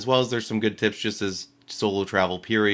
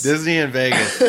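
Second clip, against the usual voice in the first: laughing; 4 semitones higher, 6 dB louder than usual. Real, not acted. Laughing repeat of joke.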